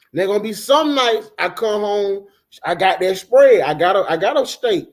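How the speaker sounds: usual speaking voice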